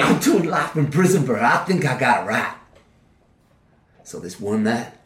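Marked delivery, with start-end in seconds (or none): 0.0-2.5 defiant shout. Acted. Prisoner snaps back at father.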